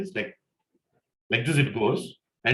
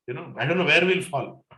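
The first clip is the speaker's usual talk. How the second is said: ordinary talk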